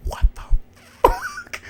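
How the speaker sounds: a stunned pause, then fuck cracks way up high; shocked disbelief. Retold or imitated. Reacting to the episode twist with stunned disbelief. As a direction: drag it out, then the last word jumps up in disbelief.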